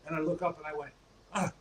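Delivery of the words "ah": dawning recognition. Retold or imitated. Recognizes the famous wrestler.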